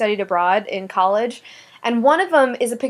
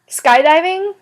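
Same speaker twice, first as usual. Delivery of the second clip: high, loud disbelief; can't believe what you just heard; incredulous; 8 semitones higher, 5 dB louder, slower than usual. Real, not acted. Friend plans to go skydiving.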